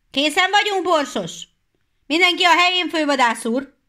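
loud call across a field; loud hunter's call. Acted. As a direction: shout it to someone far away.

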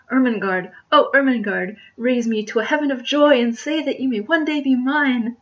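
loud, overwrought melodramatic pleading; ardent suitor. Acted. Jack begs Ermengarde to be his, ardently. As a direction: plead like a stage hero on his knees. Jack's melodramatic proposal of love.

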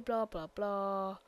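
usual speaking voice

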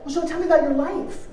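mock small-talk question. Mocking a bad opening question.

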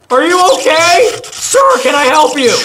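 high, loud shouted questions; alarmed calling out. Real, not acted. Alarmed calls to game figure.

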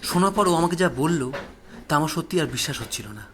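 ordinary delivery, nothing marked

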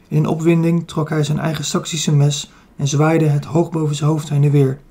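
ordinary delivery

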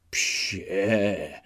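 usual speaking voice

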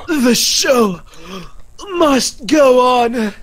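loud, drawn-out stage proclamation; theatrical declaration. Acted. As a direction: declaim it to the back row, slowly. Game line declaimed after a gasp.

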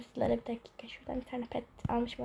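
soft breathy whisper to herself; hushed whisper while browsing pets. Real, not acted. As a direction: whisper it like a secret aside. Showing pets, hushed aside.

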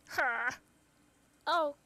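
ordinary talk

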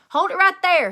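loud, high grouchy holler. A narrator's reading. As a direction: stop her in her tracks. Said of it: "he hollered".

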